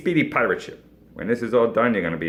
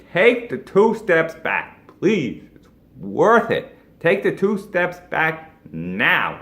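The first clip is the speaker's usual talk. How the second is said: slow, deliberate, higher push on each word; slow emphatic urging; 6 semitones higher, 4 dB louder, much slower than usual. Real, not acted. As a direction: drive the advice home word by word. Urging client to restructure.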